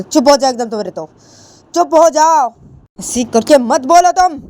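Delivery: high, loud angry shout to shut her up; a mother slapping and yelling silence; furious shout. Acted. Angry mother silences Ritu, loud high shout. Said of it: "गुस्से में आके".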